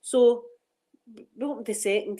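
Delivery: usual speaking voice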